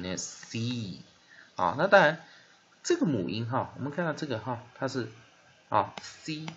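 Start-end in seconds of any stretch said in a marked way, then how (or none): 0.2-1.0 "C" as a stretched see sound; soft C. Shown as a demonstration. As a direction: draw out the vowel in "C". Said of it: "所以我们念".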